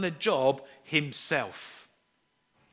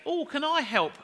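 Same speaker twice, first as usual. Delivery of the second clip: put-on much higher, eager child's offer; little girl's voice; 11 semitones higher than usual. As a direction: ask sweetly like a small girl. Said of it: "she would say".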